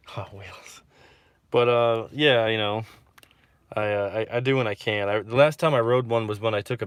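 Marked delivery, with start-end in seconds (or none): 0.1-0.8 laughing whisper of the nickname; whispered aside. Real, not acted. Repeating girlfriend's nickname for the governor, giggling whisper. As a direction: breathe it like a secret joke.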